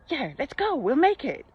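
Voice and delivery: rally him with easy confidence; upbeat encouraging. Retold or imitated. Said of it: "Completely different energy".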